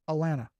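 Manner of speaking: "Atlanta" as uh-LAN-uh, t's dropped; local Southern pronunciation. Shown as a demonstration. Trader demonstrates after spelling, "Atlanta" as local Alanna. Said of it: "That's just how we say it".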